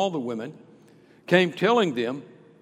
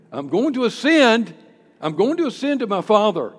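louder, higher, building declaration; emphatic Jesus; 5 semitones higher, 9 dB louder than usual. Acted. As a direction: announce it with conviction. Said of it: "He was saying".

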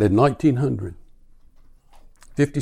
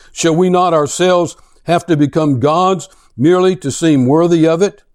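a raised, declaiming reading voice; dramatic reading; 3 semitones higher, 4 dB louder than usual. Acted. Preacher reads The Madman to his congregation, dramatic reading. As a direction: read it like a tale of a madman.

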